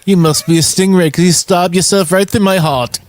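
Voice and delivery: put-on high-pitched, failed Australian accent; badly faked Australian twang. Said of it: "I can't do Australian".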